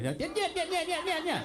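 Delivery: high, repetitive mock Russian nyets; Zelensky. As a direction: protest in a high put-on voice. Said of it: "I wish Zelensky said that to Trump".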